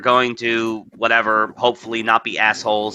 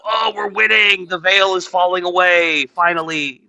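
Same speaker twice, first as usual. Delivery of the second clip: mocking triumph; 8 semitones higher than usual. Host mimics opponents seizing on mild criticism, mocking triumph. Mocking anti-Israel side's celebrating.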